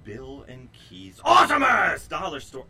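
very loud booming announcer shout; monster truck announcer. Acted. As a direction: roar it like a monster truck commercial. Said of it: "I can't stop saying it like that".

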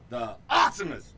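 booming loud announcer voice; monster truck announcer. Acted. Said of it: "monster truck announcer".

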